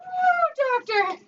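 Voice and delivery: high excited squeal; delighted high-pitched ooh. Real, not acted. Excited exclamation at the game.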